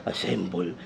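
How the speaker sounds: quiet command. Retold or imitated. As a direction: say it under your breath, too softly. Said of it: "Di ba dapat nilakasan niya yan?".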